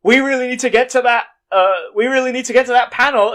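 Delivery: high, loud, panicked rush; hammed-up scared women fleeing; mock panicked escape. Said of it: "And then they go".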